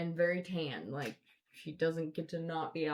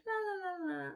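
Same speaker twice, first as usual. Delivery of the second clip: mock sing-song la la; 13 semitones higher than usual. Couple ignoring the screaming lady.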